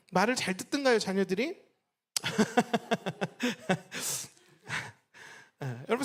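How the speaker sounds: ordinary talk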